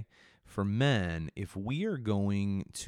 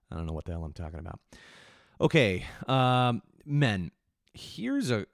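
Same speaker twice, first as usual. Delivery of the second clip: ordinary talk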